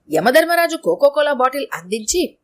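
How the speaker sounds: plain reading voice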